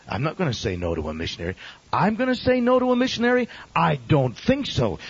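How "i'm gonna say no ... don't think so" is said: incredulous. Real, not acted. Preacher scoffing at turning away a missionary, incredulous. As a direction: throw the idea back in disbelief.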